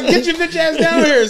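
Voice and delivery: high and laughing; laughing call. Retold or imitated. Calling his friend down to the seats, laughing. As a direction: yell it down playfully, laughing.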